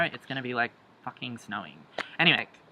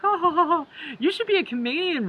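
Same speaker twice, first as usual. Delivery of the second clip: much higher, louder mock laughing voice; imitating his laugh; 5 dB louder than usual. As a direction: ham up his cracking-up laugh. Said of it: "he cracked up".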